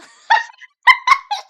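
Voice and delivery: high, loud burst of laughter; squealing laugh; shrieking laugh. Real, not acted. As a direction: crack up at your own mistake. Laughing at own bad French.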